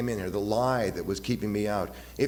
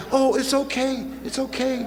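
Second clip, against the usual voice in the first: much higher, hushing woman's voice; soothing woman; 13 semitones higher, 4 dB louder than usual. Retold or imitated. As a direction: comfort him like a startled girlfriend. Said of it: "the girl would say".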